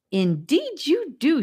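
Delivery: high, drawn-out, grand declaration; dramatic. A narrator's reading. Hymnia replies to red-haired Charlie, dramatically. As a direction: declare it slowly with theatrical flourish. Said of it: "returning to her dramatic tone".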